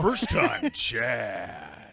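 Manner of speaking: drawn-out goofy bit voice. Pretend. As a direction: stretch it out slowly in a low joke voice. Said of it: "Doesn't get the voice".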